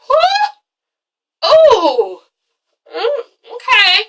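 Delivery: high, startled exclamations; shocked reaction. Retold or imitated. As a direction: react as if caught off guard. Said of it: "I was like".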